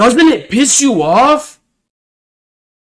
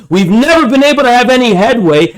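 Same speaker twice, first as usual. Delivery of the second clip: ordinary talk, nothing marked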